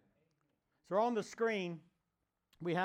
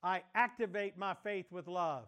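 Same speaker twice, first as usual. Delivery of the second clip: forceful declaration; 3 semitones higher, 3 dB louder than usual. Real, not acted. Pastor leading the congregation in declaration, loud and forceful. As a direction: call it out loud and strong, line by line. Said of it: "say it like you mean it".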